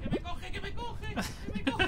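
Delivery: panicked yelling. Real, not acted. Chased by a monster in game.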